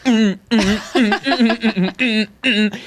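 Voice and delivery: high, rhythmic "mm" on the beat; dancing grunts. Retold or imitated. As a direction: playfully hum beats right in someone's face. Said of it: "like, right to her face".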